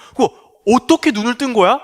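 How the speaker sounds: astonished neighbour. Acted. Neighbours question the healed man.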